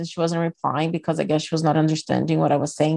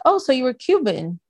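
lightly voiced small-town woman; 6 semitones higher than usual. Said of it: "she's like".

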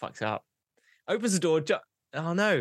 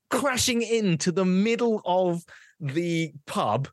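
slow, drawn-out, higher-pitched climax; dramatic build-up; 6 semitones higher, slower than usual. A narrator's reading. Joke teller building to the punchline, slow dramatic delivery. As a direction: stretch each word for suspense.

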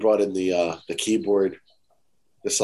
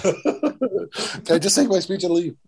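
slow, higher plea through laughter; laughing mock plea; 8 semitones higher, slower than usual. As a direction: beg half-jokingly while laughing. Pleading with parents, laughing.

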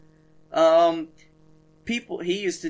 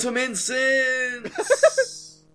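mock ad pitch; 7 semitones higher, 4 dB louder, much slower than usual. Mock ad for the school.

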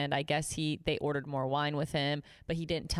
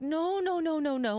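high wail of repeated denial; mock-panicked disbelief; 11 semitones higher than usual. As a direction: replay dawning horror, pitched way up. Retold horror at hour-long call.

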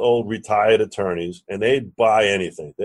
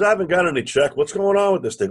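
high-pitched demand for answers; insistent complaint; 7 semitones higher than usual. Retold or imitated. Lender calling about his missing check, insistent. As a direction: press him for news, voice raised in pitch. Acted call chasing his money.

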